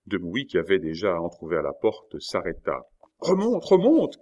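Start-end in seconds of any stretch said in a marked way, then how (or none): 3.2-4.2 very high, loud alarmed cry; young woman's urgent warning cry. A narrator's reading. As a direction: shriek a warning down to him. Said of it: "cria la jeune femme".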